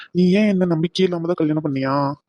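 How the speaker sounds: ordinary talk, nothing marked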